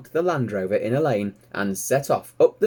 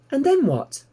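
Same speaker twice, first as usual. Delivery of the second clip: very high, louder little pig voice; eager piglet; 5 dB louder than usual. Acted. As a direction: squeak it out like a curious piglet. Piglet Babe asks.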